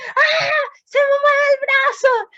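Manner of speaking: high-pitched acted shriek of amazement; astonished cry. Preacher imitates healed sister whose stiff arm moves, astonished cry. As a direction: imitate a woman crying out in sudden wonder. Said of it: "la hermana empieza así".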